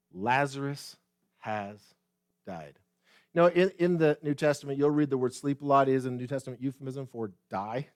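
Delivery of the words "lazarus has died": slow, low words with pauses, sinking softer; plain, grave telling. Acted. Preacher voices Jesus telling disciples plainly, slow and grave. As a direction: state it word by word, dropping away. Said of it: "Then Jesus told them plainly".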